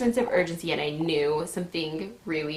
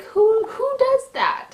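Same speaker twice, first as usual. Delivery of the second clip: high-pitched, incredulous outburst; indignant; 4 dB louder, slower than usual. Real, not acted. Woman questions nurse's order to sit still, indignant high voice. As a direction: squeal it in disbelief.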